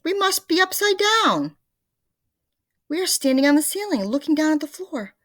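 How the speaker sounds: very high, loud alarmed voice; a panicking old man; frantic Mr Twit. A narrator's reading. The Twits panicking.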